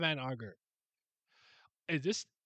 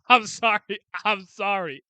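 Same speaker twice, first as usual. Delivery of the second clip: usual speaking voice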